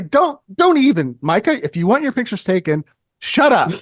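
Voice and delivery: high, loud mock-indignant warning; mock scolding. Photographer threatening the musician's photo shoot, in mock outrage. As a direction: cut him off with hammed-up outrage.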